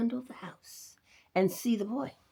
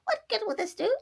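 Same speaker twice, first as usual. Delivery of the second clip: much higher prim housekeeper voice; Nurse Jane. A narrator's reading. Rabbit imagines Nurse Jane asking, high fussy voice. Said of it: "she might ask".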